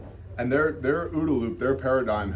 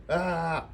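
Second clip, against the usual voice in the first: high-pitched pretend wail; mock scream; 6 semitones higher than usual. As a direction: imitate a screaming driver at talking volume. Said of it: "Semi driver screaming".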